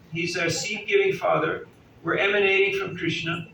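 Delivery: usual speaking voice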